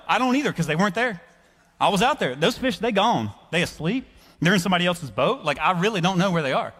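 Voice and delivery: high-pitched, exasperated comic protest; arguing. Acted. Simon explaining his fishless night to Jesus, arguing. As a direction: make the case point by point, put out. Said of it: "some reasonable arguments with Jesus".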